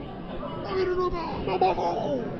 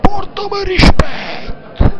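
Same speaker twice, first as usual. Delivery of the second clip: higher, slow furious demand; angry shout; 6 semitones higher, 11 dB louder, slower than usual. Acted. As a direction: bellow it, furious boss. Chef demands respect. (urlo furioso lento)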